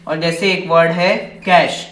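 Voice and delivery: ordinary delivery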